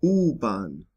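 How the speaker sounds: U-Bahn opening on a long oo. Shown as a demonstration. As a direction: draw out the U in U-Bahn as in loop. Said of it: "when it's long".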